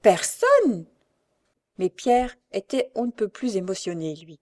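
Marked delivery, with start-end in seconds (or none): 0.0-0.9 loud, high repeat bursting out; ringing exclamation. A narrator's reading. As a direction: echo it with ill-hidden satisfaction, ringing out. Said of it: "avec un éclat de voix plus sonore".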